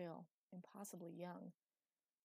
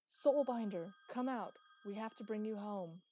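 full-force bellow, much louder and higher; 3 semitones higher, 12 dB louder than usual. A narrator's reading. Thunder calling the Soulbinder out, bellowing. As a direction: bellow a demand into the dark.

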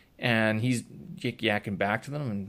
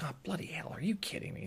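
1 muttered exasperation; 6 semitones higher, 12 dB quieter, faster than usual. Retold or imitated. Bad news from the landlord.

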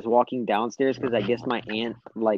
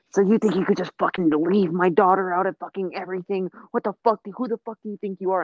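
high-pitched furious aunt, retold; shrill scolding rant, quoting the aunt; aunt yelling; 7 semitones higher than usual. Aunt cussing out niece for leaving her daughter, high angry yell. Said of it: "she's cussing me out".

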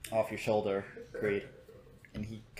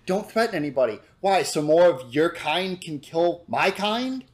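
monkey rebuking the slayer, louder; indignant monkey; 6 semitones higher, 8 dB louder than usual. Acted. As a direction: throw the accusation back. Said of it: "and goes".

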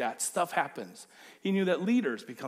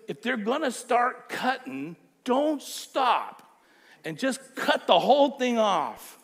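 loud, higher angry outburst; upset Paul; 7 semitones higher, 7 dB louder, slower than usual. Retold or imitated. Paul furious at the circumcisers, shouting higher. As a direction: let the anger boil over. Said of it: "That's how upset he is".

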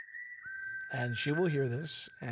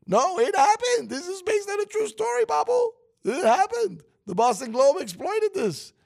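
high-pitched, loud outraged rant; worked-up indignation; 8 semitones higher, 5 dB louder than usual. Acted. Host telling his co-host the film's scandal is true, in high, loud indignation. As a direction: insist at the top of your register, incredulous.

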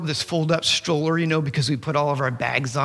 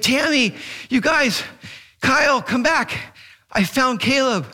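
high, raised, urgent call; retold calling out; 7 semitones higher, 3 dB louder than usual. Dad calling wife and son back, hollering. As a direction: holler to bring the family back.

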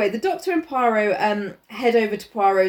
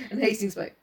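ordinary talk, nothing marked

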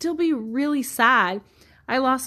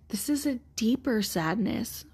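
hushed, gentle therapist line; soft quoted therapist; 6 dB quieter than usual. Retold or imitated. Therapist gently naming her client's grief, hushed. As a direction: say it softly, as the therapist did. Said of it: "she looked at me and she said".

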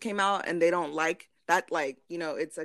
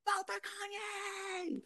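very drawn-out, high, softer chant; mock fickle fans; 13 semitones higher, 9 dB quieter, much slower than usual. Mocking fans who cancel then back [name], long high mock cheer. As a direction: stretch out a parody campaign cry. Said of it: "y'all will say y'all cancel somebody".

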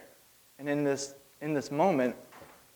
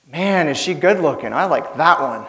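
louder, eager admiring; smitten young man; 3 semitones higher, 8 dB louder than usual. Acted. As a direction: gush over her like a smitten suitor. Zach admiring Abigail.

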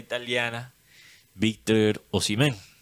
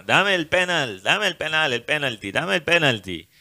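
higher, louder teasing singsong; mocking voice; 7 semitones higher, 4 dB louder than usual. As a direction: taunt him, sly and mocking. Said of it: "en una voz burlona".

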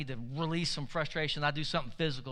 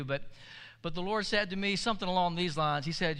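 ordinary delivery

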